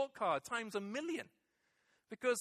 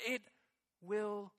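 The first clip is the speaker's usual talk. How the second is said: ordinary delivery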